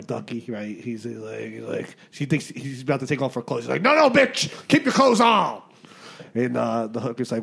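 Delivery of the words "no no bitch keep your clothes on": loud, high-pitched mock outrage; bossy drunk yell. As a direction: bellow the order as the joke's drunk. Drunk man orders hooker in joke.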